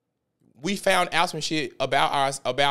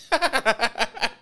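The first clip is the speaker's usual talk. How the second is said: a sharp laugh that rises in disbelief; disbelieving laugh; 7 semitones higher than usual. Real, not acted. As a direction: laugh out at the absurdity. After the pool quote.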